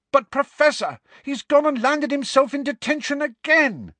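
very high, exasperated wail; outraged protest. Acted. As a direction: complain loudly at a very high pitch. Pupil protests to the professor.

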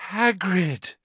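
high, loud croak of relief; relieved croak. A narrator's reading. Harry spots Hagrid striding towards him, relieved croak. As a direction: croak it out, glad to be found. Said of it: "Harry croaked in relief".

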